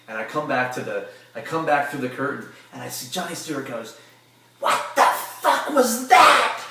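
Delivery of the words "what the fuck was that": very high, loud, drawn-out yell; imitated backstage tirade, pitched way up; retold angry outburst. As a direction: bellow it slowly as the furious boss.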